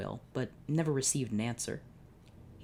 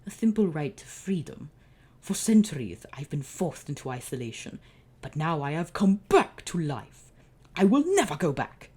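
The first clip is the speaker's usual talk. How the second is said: menacing villain; 3 semitones higher, 6 dB louder than usual. Acted. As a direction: swell into a vow. Villain declares his freedom.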